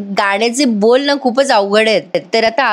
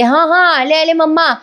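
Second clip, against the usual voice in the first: daughter answering call; 7 semitones higher than usual. Retold or imitated. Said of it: "अस्मिता म्हणते".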